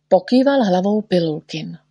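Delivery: ordinary delivery, nothing marked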